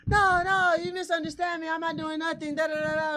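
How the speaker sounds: high, loud mock Italian-accent denial; protesting Italian-accent innocence. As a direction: play the caught pickpocket in an Italian accent. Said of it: "talk to me with this accent".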